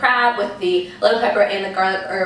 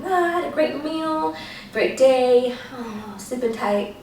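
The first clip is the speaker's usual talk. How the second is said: dreamy sleepy voice; 5 semitones higher, slower than usual. Retold or imitated. She recalls drifting off happily.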